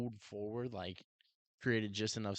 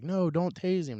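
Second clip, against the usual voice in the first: high, louder whiny "no, don't tase him"; mock pleading; 8 semitones higher, 5 dB louder than usual. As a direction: parody the staff begging. Officers pleading, retold.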